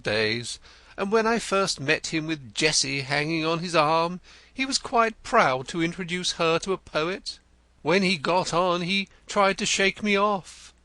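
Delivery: Denzil the poet. A narrator's reading. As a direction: play him florid and eager. Denzil answers Wimp.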